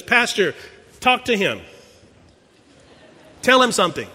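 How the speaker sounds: sharp, loud pleading command; grieving widow snapping at the young pastor; angry demand. Retold or imitated. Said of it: "She looks at me and says".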